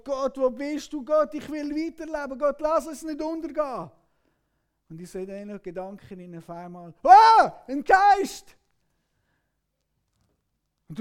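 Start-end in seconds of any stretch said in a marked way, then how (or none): 0.0-3.9 high, loud pleading in panic; desperate cry to God. Acted. Disciple in the storm crying out to God, high desperate plea. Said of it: "du schreist innerlich zu Gott". 7.0-8.6 shrieking high and very loud; terrified scream. Acted. Frightened disciple spotting a ghost, shrill scream. Disciples see figure on water.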